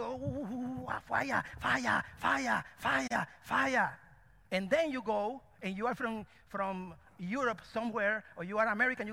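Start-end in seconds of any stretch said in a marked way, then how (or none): 0.0-4.0 mock fervent chant, breaking on each cry. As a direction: imitate excited churchgoers crying out. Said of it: "all this noise".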